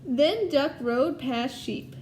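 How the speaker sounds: lively storybook read-aloud to a class; reading to children. Acted. Teacher reading Duck on a Bike to her class, storytelling voice. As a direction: tell it brightly so the kids follow along.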